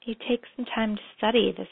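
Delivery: calm, unhurried meditation guide; guided meditation. Acted. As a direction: lead them gently into memory.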